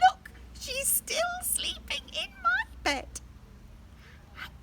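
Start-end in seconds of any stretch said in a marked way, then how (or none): 0.1-3.2 very high, slow little bear voice; high excited cub voice; Baby Bear. Acted. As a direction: squeak it like a tiny cub.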